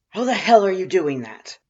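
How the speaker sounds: little brother yelling in bewilderment; loud baffled shout. Acted. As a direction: bellow it in confusion. Boss bewildered and irritated.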